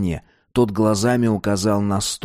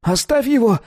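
very high panicked plea; desperate cry; 13 semitones higher, 3 dB louder than usual. A narrator's reading. Dealer begs for his son, desperate cry. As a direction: cry out in a squeaky, frantic voice. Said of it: "закричал Рублёвка".

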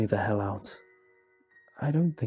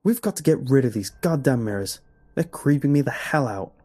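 louder, worked-up demand; agitated; 4 semitones higher, 6 dB louder than usual. A narrator's reading. Father tells wife about the mirrors, agitated. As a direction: insist with exasperated force.

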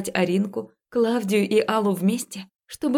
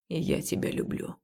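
voiceless tender whisper; 3 semitones lower, 8 dB quieter than usual. Acted. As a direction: whisper it softly. Man repeats love in the dark.

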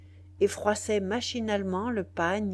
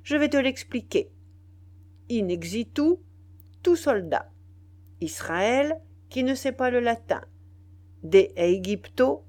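louder, raised-voice pronouncement; pompous lecturing; 5 semitones higher, 6 dB louder than usual. A narrator's reading. Chief mistranslating Latin for an ignorant soldier, pompously. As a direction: declaim phrase by phrase with authority.

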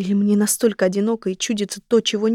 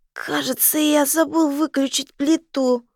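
put-on slow, high drunken voice on забыл; a tipsy man blurting it out; 6 semitones higher than usual. Drunk man's odd line.

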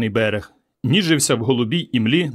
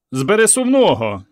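higher shout of the circus name; shouted aloud; 7 semitones higher, 3 dB louder than usual. A narrator's reading. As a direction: call it out to the whole beach. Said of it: "вголос крикнув він".

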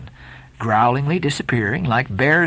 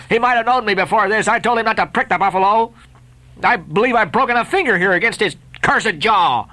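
high, agitated Lakeman pacing the barricade; jerking out sentences; 7 semitones higher than usual. Acted. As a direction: spit the lines out in short bursts. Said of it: "jerking out such sentences as these".

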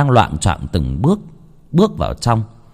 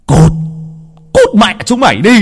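high, loud shouted order; throat-tearing yell; 11 semitones higher, 6 dB louder than usual. Acted. Husband driving his wife out, throat-tearing scream. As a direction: scream them out the door. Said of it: "hét như sắp rách toạc cả cuống họng".

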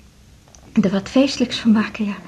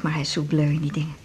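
ordinary delivery

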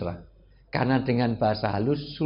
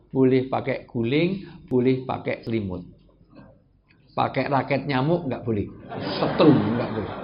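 ordinary delivery

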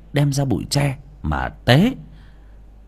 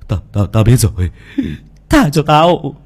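high, loud frantic begging; crazed pleading; 10 semitones higher, 8 dB louder than usual. Acted. Maddened Hiếu begs the ghost, high and loud. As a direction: beg the ghost for mercy, half mad. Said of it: "nói những câu làm người ta khó hiểu".

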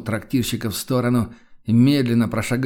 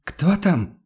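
taken aback; 7 semitones higher than usual. Acted. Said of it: "голос оторопело повторил".

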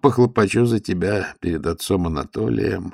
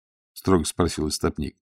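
ordinary delivery, nothing marked

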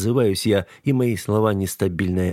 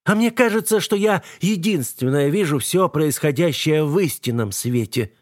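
scornful woman; 7 semitones higher than usual. A narrator's reading. Eva insists she sees truth.

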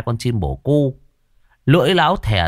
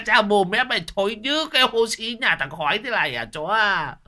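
put-on high shrieking old man, disgusted and outraged; old man screeching at the dog for licking his mouth; shrieking outrage; 11 semitones higher than usual. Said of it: "thì rú lên".

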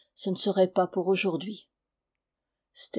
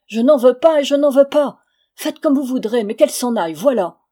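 loud, higher, exasperated refusal; desperate outburst; 5 semitones higher, 11 dB louder than usual. A narrator's reading. Husband rejects his wife before crowd, desperate. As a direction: hurl the words at the crowd. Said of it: "d'un air désespéré".

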